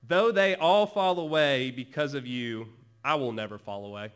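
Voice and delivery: loud, bold declaration, easing off at the end; so confident. Acted. Peter vowing loyalty to Jesus, confident and loud. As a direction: proclaim it with total self-assurance. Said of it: "So confident".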